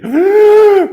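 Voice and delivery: huge high-pitched gasp; exaggerated, loud gasp of amazement; mock shocked gasp. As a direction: gasp like a stunned junior. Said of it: "막 이러는 거야".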